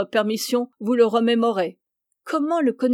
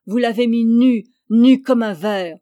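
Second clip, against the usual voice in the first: a much louder, slowing accusation; vehement accusation; 7 dB louder than usual. A narrator's reading. Joson accuses the guard loudly of stripping the boy. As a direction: hurl the charge at him.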